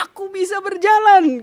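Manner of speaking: teriakan haru, tinggi dan lantang; seruan sukacita. Retold or imitated. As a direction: serukan mukjizat itu sekuat tenaga. Menirukan seruannya saat bisa berjalan. (berseru penuh kemenangan)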